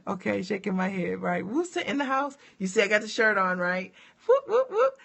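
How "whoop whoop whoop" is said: high, loud whooping cheer; excited cheer. Real, not acted. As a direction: whoop it up like a proud fan. Cheering, showing her college shirt.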